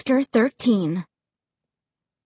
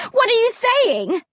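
high frightened cry; cried out; 11 semitones higher than usual. A narrator's reading. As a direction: cry out in disbelief. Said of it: "I cried".

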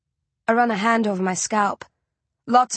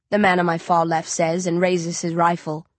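usual speaking voice

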